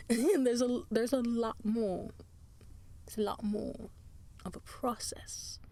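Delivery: light put-on British accent. Podcaster explaining the platforms, slipping into a British accent. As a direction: drift into British vowels for fun. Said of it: "keep going into a British accent".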